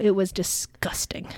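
slow, hushed whisper of disgust. Real, not acted. Telling her co-host about the frappuccino, slow and whispered. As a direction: confide the verdict in a slow whisper.

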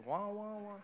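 high, wordless wah-wah drone; Charlie Brown's teacher. Acted. Comedian mimicking what a baby hears, droning wah-wah. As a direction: imitate a muffled grown-up as trombone-like wahs. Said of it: "Charlie Brown's teacher".

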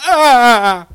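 loud high choked gasp; a pained emotional ah; overwhelmed cry of ah. Retold or imitated. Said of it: "I was like".

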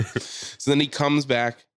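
ordinary delivery

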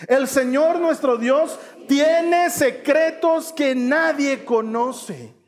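slow, high-pitched choral-style reading; leading group reading. Shown as a demonstration. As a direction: read it out big for all to join. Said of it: "Otra vez vamos a leerlo juntos".

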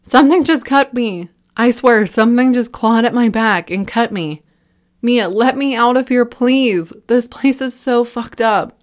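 high, loud, near tears; panicked scream. A narrator's reading. Liam begging Mia to let him out, panicked and near tears. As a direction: scream in terror, almost crying. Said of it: "Liam was screaming and on the verge of tears".

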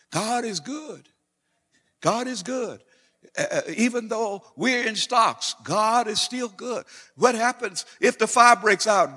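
high, ringing preacher's cry; full-force preaching. Real, not acted. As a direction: preach it high and loud, stretching out the praise. Preaches on singing in prison.

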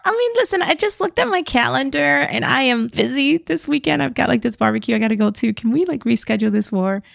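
high-pitched, dithering mock excuse; reluctant general making excuses. Friend plays the general dodging battle, hammed-up dithering.

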